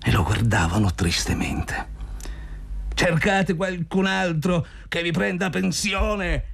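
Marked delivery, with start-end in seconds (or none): 2.9-6.4 grido teatrale molto acuto e strascicato. A narrator's reading. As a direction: grida in alto, allungando le parole, senza forzare il volume. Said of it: "gridò Zotov". (grido acuto, in italiano)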